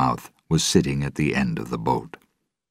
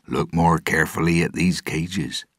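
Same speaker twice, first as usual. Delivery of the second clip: plain reading voice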